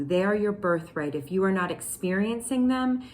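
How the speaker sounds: usual speaking voice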